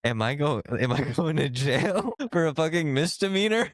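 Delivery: half-laughing, act out rising panic; mock panic. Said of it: "Like, panicking in my head, spiraling".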